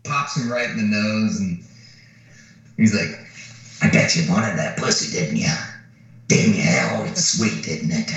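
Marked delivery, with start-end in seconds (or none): 3.8-8.1 lower, gloating taunt; a hint of a mean brute sneering; taunting Nick Nolte. Big man taunts the guy he just punched, low sneer. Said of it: "Nick Nolte is a fucking riot".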